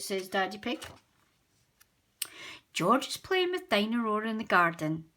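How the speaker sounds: ordinary delivery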